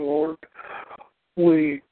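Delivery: usual speaking voice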